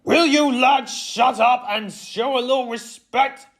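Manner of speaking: high, loud angry outburst; snapped. A narrator's reading. Coach silencing laughing players, angry high shout. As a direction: snap at them at full voice. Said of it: "Bradley, he snapped".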